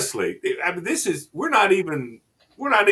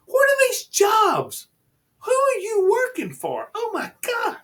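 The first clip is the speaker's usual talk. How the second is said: can't believe what she's reading, cry it out; incredulous outburst. Real, not acted. Outraged disbelief at pet insurance.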